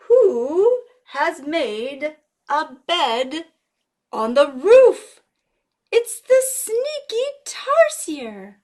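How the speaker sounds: slow, high and loud build; dramatic reveal. Acted. Revealing the sneaky tarsier to children, dramatic. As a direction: draw it out like a big secret.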